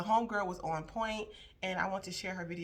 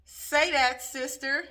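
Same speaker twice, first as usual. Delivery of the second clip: higher and louder, cheering her on; hype cheer; 6 semitones higher, 10 dB louder, slower than usual. Real, not acted. Host hyping up the TikTok girl with a loud cheer. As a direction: shout your agreement at the screen.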